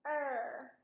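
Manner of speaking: "Er." with a bunched tongue, slow and exaggerated; modelled bunched R. Shown as a demonstration. Therapist modelling a bunched R for the child in the mirror. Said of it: "One more time".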